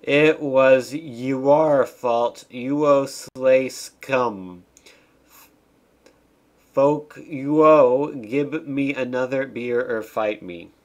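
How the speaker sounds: loud, drawn-out hammed-up challenge; mock angry accusation. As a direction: play a slow, blustering bar-fight threat. Angry cutscene character read aloud.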